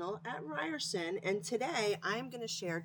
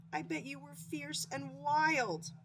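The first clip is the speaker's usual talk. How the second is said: admiring hedgehog; 7 semitones higher, slower than usual. Acted.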